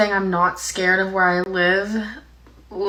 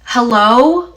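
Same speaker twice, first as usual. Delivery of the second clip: loud, raised call into the distance; imitated yelling; 4 semitones higher, 9 dB louder than usual. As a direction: imitate dad calling out to someone far away. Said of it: "started yelling off into the distance".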